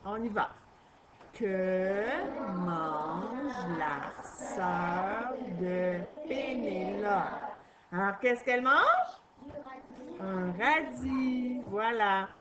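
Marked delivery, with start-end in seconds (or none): 1.3-7.1 slow read-aloud. Shown as a demonstration. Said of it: "point d'interrogation".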